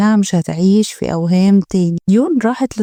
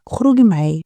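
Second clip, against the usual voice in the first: ordinary delivery, nothing marked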